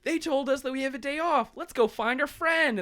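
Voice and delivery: very high, perky voice; mock chipper friends. Friends heading off to visit, mock chipper. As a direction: chirp it like eager friends.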